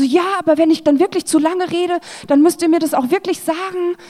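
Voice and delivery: anxious self-imitation. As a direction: replay your nervous apology. Retelling her own anxious apology.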